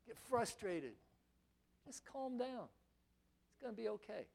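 soft aside to soothe; hushed calming. Acted. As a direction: settle someone down very quietly. Soothing the frustrated complainer.

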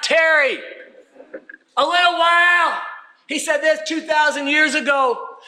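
high, urgent pulpit exhortation; full-force preaching. Acted. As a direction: preach it out to rally the congregation. Proclaims scripture at full force.

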